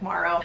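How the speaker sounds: Morrow said as marrow; Morrow with open a, mar-row; Frank's way. Retold or imitated. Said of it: "sounds like he's saying M A R R O W".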